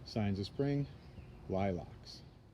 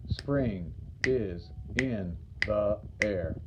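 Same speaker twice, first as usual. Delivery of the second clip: "Spring is in the air" slowly, each syllable separate; 2 semitones lower, much slower than usual. Shown as a demonstration. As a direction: count out "Spring is in the air" with gaps between syllables. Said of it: "Five syllables".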